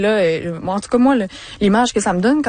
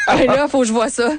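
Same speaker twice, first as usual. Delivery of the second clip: laughing; 4 semitones higher than usual. Real, not acted. Eager to see duck call.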